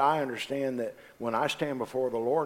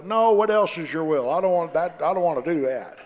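whiny refusal; 5 semitones higher, 6 dB louder than usual. Retold or imitated.

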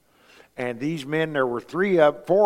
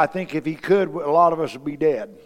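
ordinary talk

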